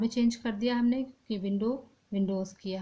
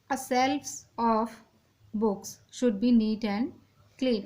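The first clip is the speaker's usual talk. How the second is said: slow dictation; much slower than usual. Shown as a demonstration. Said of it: "shelves of books नहीं होता है".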